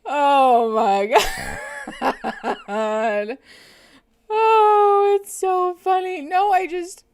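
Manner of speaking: loud, high cries through laughing; shrieking with laughter; helpless laughter. Real, not acted. To the chat, overcome by a funny Survivor memory, shrieking with laughter.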